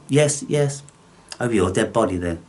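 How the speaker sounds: ordinary talk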